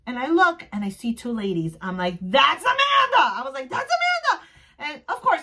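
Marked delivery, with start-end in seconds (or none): 2.2-3.2 high, loud, drawn-out shout; excited shriek. Retold or imitated. Fan spotting Amanda across the room, excited shriek. 3.7-4.5 very high-pitched starstruck squeal; excited squeal. Retold or imitated. Fan telling companion it's Amanda, squealing. Repeats her excited recognition.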